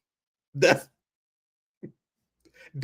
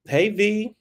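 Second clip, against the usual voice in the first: sweet greeting; 9 semitones higher than usual. Retold or imitated. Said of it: "Kendra was like".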